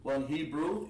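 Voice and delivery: normal talking voice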